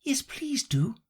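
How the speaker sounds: high unvoiced pleading; pleading whisper. Alice pleading with the Dormouse for a story. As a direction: beg in a whisper. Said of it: "pleaded Alice".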